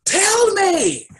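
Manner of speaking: high, piercing scream of desperation. Retold or imitated. Man screaming at the voice for answers, high and desperate. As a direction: scream it out, at the end of your rope. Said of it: "I screamed".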